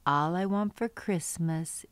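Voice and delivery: gentle, sing-song picture-book read-aloud; reading to children. Acted. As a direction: read it like a bedtime book for little ones.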